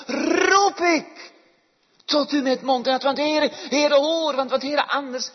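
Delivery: loud, high, desperate plea to God; crying out in prayer. Acted. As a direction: call to the Lord from the depths. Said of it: "hoe meer het schreeuwt uit de diepten". (luid roepend smeekgebed)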